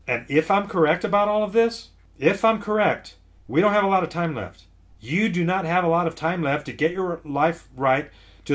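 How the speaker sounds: high-pitched, forceful preacher's warning; urgent warning. Real, not acted.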